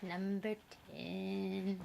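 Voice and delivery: slow, drawn-out reveal; dramatic announcement. Pretend. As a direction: announce it like a game show host. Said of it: "I don't know why I did that".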